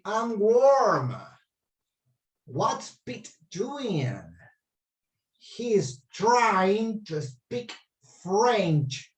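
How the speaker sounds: slow, careful model reading. Shown as a demonstration. Teacher reads slowly to the class as a model. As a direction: stretch each word out for the learners. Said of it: "I'm gonna read it very slowly".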